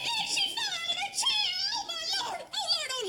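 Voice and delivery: high, frantic alarm; panicked cry. Acted. Daughter sees mother fall, high panicked cry. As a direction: cry out as if she's hurt.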